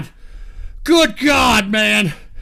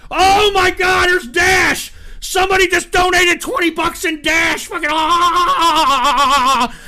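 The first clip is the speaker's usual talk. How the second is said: thrilled yelling, pitch way up; excited shouting; 5 semitones higher, 4 dB louder, slower than usual. Real, not acted. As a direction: scream with delight at the donation. Donation alert, wild excitement.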